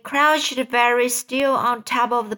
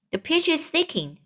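mild shout; 7 semitones higher than usual. A narrator's reading. Grasshopper warns the others about a leak, mild shout. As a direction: call out the alarm, lightly. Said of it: "shouted the, the old green grasshopper".

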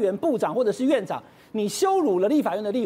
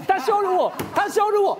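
indignant protest; 7 semitones higher than usual. Retold or imitated. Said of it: "他都气到说".

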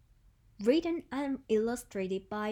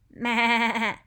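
loud, quavering bleat; sheep bleating; 2 semitones lower, 6 dB louder than usual. Shown as a demonstration. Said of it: "it's a sheep".

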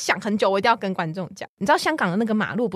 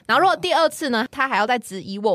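ordinary talk

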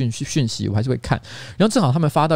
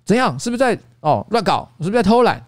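higher-pitched, half-joking grilling of the staff; mock interrogation; 7 semitones higher than usual. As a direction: press them, lightly accusing. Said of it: "有一点点在质问大家说".

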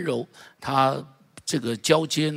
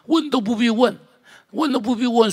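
emphatic; 10 semitones higher, 4 dB louder than usual. Real, not acted. Emphatic sermon point.

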